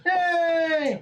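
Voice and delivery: high, drawn-out celebratory squeal; gleeful cheer. Real, not acted. Player escapes bloodstains, high gleeful cheer.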